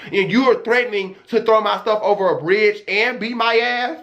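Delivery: normal talking voice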